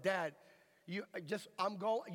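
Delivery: ordinary talk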